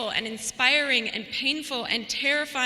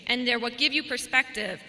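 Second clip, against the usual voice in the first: ordinary talk, nothing marked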